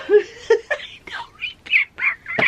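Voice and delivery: squeaky, slow words through laughter; laughing. Real, not acted. Laughing at herself for forgetting the dye colors, giggling. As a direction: giggle it out, way up high.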